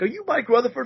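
mock starstruck squeal; starstruck fan. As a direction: play the thrilled fan. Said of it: "He's like".

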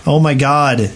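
normal talking voice

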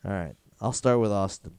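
ordinary delivery

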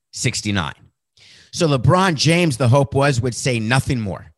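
ordinary talk

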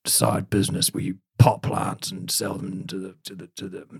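Billy Butcher. Retold or imitated. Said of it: "Do Your Best Billy Butcher impression".

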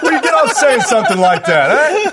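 hammed-up Italian-American tough guy, high and laughing; mock Jersey Italian 'eh?' taunt; over-the-top Italian-American accent. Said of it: "did [name] just make fun of Italians".

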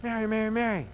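gently calling her name, lightly voiced angel; a hint of a gentle angel calling out; angel calling Mary. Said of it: "What does the angel do?".

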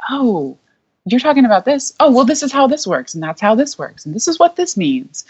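high-pitched retold "oh, I get it" moment; dawning realization. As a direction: act out things suddenly clicking, pitch up. Acts out her sudden understanding.

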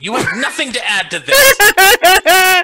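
high, slow laughing shout; mock yell. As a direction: shout it while cracking up. Mock scolding cohost, laughing.

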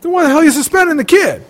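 high-pitched exasperated challenge; indignant protest. Retold or imitated. Parent challenging the principal over a suspension, indignant. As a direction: demand an explanation, pitch shooting up.

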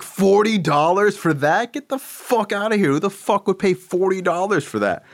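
high-pitched mock outrage, stretched-out words; outraged disbelief. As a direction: squeal in disbelief at the price. Acted outraged reaction to a price.

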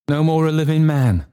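high, horrified cry; Trotty. A narrator's reading. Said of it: "cried Trotty".

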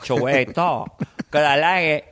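put-on thick Scarface-style Cuban accent; Tony Montana. Comic reviving his Scarface bit, Cuban-accented impression. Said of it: "I used to do Scarface or Tony Montana".

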